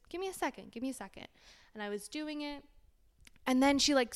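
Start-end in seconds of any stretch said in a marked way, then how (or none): 0.1-1.3 soft, polite plea; hushed little request for patience; no attitude. Retold or imitated. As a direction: retell it gently, without any edge. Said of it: "Not giving attitude, not being a bitch".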